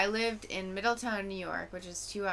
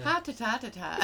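singsong gibberish Irish accent, higher; mock Irish; 4 semitones higher than usual. Shown as a demonstration. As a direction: rattle out syllables as fake Irish. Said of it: "That's how I ... It's breathy".

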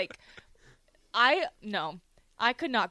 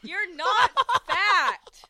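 high, loud scolding, words stretched out; exasperated shout; 10 semitones higher, 10 dB louder, much slower than usual. Real, not acted. Host scolds co-host for calling himself fat, exasperated shout. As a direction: cut him off with a drawn-out, exasperated yell.

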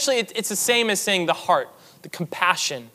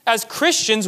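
ordinary talk, nothing marked

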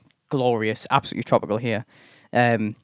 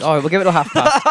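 put-on amateur South African accent, pitched up; 5 semitones higher, 3 dB louder than usual. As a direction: have another clumsy stab at South African. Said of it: "this is my South African accent".

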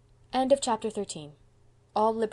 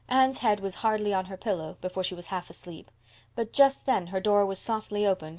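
ordinary delivery

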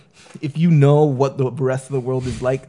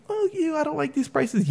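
retold yelling; 14 semitones higher, 5 dB quieter than usual. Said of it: "yelling, just yelling at him".

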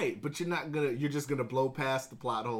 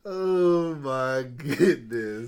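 laughing; 4 semitones higher, 13 dB louder, much slower than usual. Real, not acted. Laughing at friend.

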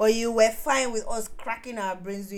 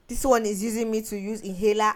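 usual speaking voice

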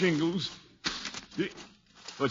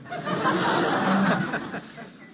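nervous laugh; 9 semitones higher, 3 dB louder than usual. Acted. Laughs at anticlimax.

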